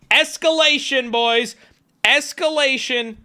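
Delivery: high, ringing call-out to the crowd; excited herald of trouble; hyped announcement. Real, not acted. Announcing the texts escalate.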